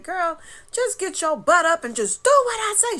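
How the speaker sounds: high, loud scolding yell; sister hollering. Retold or imitated. Sister scolding from beyond. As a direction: yell it like a bossy big sister. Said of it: "She started hollering at me".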